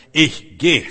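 „Ich geh“ laut und wuchtig, beide Wörter einzeln betont; entschlossener Ausruf. Acted. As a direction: den Entschluss kraftvoll herausrufen. Entschlossene Erklärung, danach leiser wiederholt. (laut und entschlossen)

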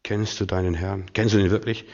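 hushed, low, searching questions; quiet inner voice, gently probing; Heiliger Geist. Retold or imitated. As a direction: speak softly to one person lying in bed. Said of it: "der Heilige Geist sagt".

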